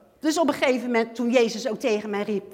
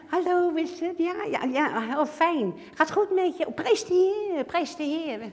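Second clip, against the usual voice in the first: higher, overly friendly greeting voice; fake sweet churchgoer; 7 semitones higher than usual. Churchgoer greeting a sister, hammed-up sweetness. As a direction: put on a sugary pious welcome.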